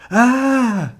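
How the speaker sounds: loud, very high scream; startled yell. A narrator's reading. Man at the door sees Lance's face, startled yell. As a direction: scream in shock at a scary face. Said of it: "The man yelled".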